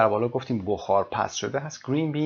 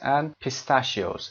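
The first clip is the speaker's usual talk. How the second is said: slow model reading; much slower than usual. Shown as a demonstration. Teacher models English item.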